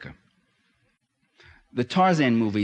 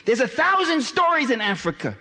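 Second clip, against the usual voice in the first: impassioned; 10 semitones higher than usual. Real, not acted. Lecturer to the audience on African stories, impassioned and raised. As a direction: pour it out, full of conviction.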